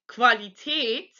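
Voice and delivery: Qualität begun with 'kv', not 'kw'; German KV sound. Shown as a demonstration. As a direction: say Qualität as 'kvah-lee-tayt'. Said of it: "it's actually the K V sound".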